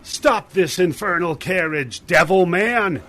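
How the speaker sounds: put-on loud, high-pitched angry old man yell. Old man yells to the carriage rider, furious shout. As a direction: yell up at the driver in outrage. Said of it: "He yells to the carriage rider".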